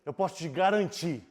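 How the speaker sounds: firm preaching close. Acted. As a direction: declare it to the congregation. Closing preaching proclamation.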